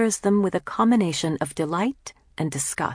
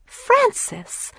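very high, scandalised cry of the name; shocked. A narrator's reading. Mercedes reacts to her sister's naughty words, shocked. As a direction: scold in prim astonishment. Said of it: "Mercedes is always shocked".

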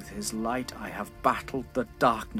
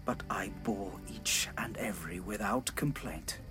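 whispered; 6 semitones lower, 11 dB quieter than usual. Acted. King's bitter confession.

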